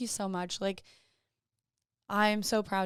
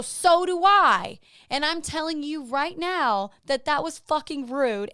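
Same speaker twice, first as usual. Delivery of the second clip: retold angry shout; 8 semitones higher, 10 dB louder, slower than usual. Confronting rude guy at bar.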